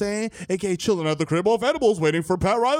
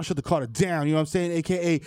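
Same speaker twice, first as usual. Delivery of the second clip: normal talking voice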